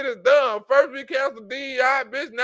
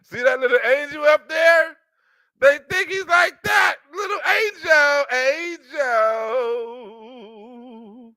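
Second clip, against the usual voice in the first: mocking angel talk; 3 semitones higher than usual. Mocking the clip's angel remark.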